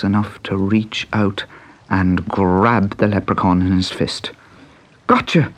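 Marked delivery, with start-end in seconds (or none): 5.1-5.5 high triumphant cry; snatch it with a cry; excited shout. A narrator's reading. Pat grabs the leprechaun.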